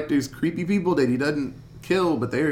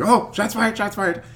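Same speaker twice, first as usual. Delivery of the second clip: panicked cop; 7 semitones higher than usual. Retold or imitated. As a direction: call it out like a rattled cop. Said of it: "the cop's like".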